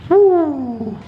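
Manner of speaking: loud high cheer; excited whoop. Real, not acted. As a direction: hype the fighter up. Hype between rounds.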